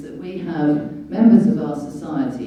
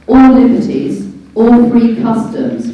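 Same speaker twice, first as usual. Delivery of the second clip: reading the charter out louder, each word weighted; emphatic reading; 6 semitones higher, 11 dB louder, slower than usual. Shown as a demonstration. Reading Magna Carta aloud to viewers, emphatic and louder. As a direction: proclaim it like a law read aloud. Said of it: "I shall read it out to you".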